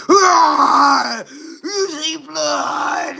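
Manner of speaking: high, wild laughing cry; hammed-up manic delight at the blood; mock crazed glee. Mocking the boyfriend with crazed glee at her own blood. Retells her reaction to the nosebleed.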